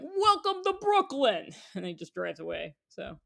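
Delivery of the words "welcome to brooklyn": high, raised-voice Brooklyn welcome; imitated shout out a car window; calling out. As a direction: holler it like a passing driver. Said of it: "And he was like".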